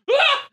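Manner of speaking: sudden high shriek of alarm; startled yell. Retold or imitated. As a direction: yelp in shock at the flames. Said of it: "and I go".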